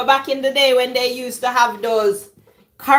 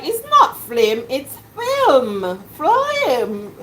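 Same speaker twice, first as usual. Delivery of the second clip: put-on lightly higher child voice, insisting; imitating child correcting; 4 semitones higher, much slower than usual. Children correcting mom's pronunciation, mild child voice. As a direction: kids insist it's "film", a touch of child voice. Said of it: "And they're like".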